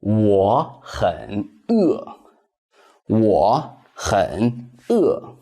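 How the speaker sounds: slow, careful Mandarin for learners; slow teacher's demo; slow model sentence. Shown as a demonstration. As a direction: say it slowly, syllable by syllable, for students to copy. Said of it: "So that is".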